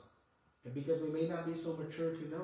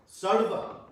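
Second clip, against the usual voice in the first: Sarva punched higher and louder with a rise; stress on Sarva; 6 semitones higher, 8 dB louder than usual. Shown as a demonstration. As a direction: hit Sarva hard.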